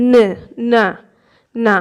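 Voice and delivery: ন, না sounded like Bengali n; demonstrated Korean n. Shown as a demonstration. Teacher modelling nieun for Bengali learners, demonstrated n. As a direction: say ন, না as separate n syllables. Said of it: "Bangla নয়ের মতো উচ্চারণ".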